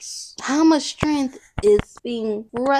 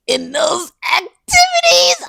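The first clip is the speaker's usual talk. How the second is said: higher, louder, breaking into laughter; cracking up; 12 semitones higher, 7 dB louder than usual. Real, not acted. Claiming innocence to viewers while cracking up. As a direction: try to deny it but lose it to laughing. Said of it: "I can't even say it with a straight face".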